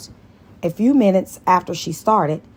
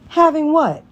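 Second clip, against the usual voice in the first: mock indignant; 11 semitones higher, 5 dB louder than usual. Cookie indignant question.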